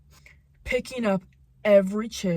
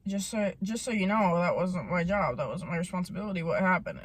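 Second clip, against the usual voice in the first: defensive disclaimer; 4 semitones lower, 5 dB quieter than usual. Retold or imitated. Retells defensive reply to friends.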